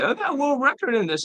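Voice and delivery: mock him as a whiny braggart; whiny mockery. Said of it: "he sounds like a whiny bitch".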